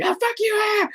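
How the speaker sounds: high mock outburst at himself; mock frustrated yell. Imitating himself mad at his painting, high mock yell. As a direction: squeal it like a mini tantrum. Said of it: "when I get mad at myself and I'm like".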